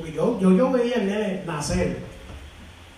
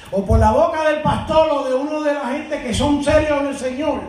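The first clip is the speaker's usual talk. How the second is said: insistent, raised pulpit delivery; forceful preaching; 4 semitones higher than usual. Real, not acted. Preacher advising congregation to hold on to God's word, forceful preaching. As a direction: press it on the congregation.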